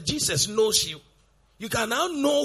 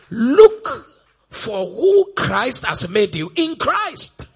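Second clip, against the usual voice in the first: emphatic preaching; 9 dB louder, slower than usual. Real, not acted.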